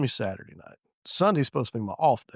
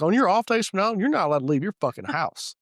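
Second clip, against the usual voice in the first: retold scolding; 8 semitones higher, 5 dB louder than usual. Retold scolding of troublesome officer.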